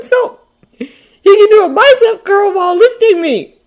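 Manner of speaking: put-on high, loud boyish rambling; excited bragging. A narrator's reading. Peter excitedly brags to Ned.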